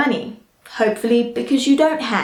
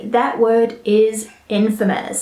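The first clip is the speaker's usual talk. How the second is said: ordinary talk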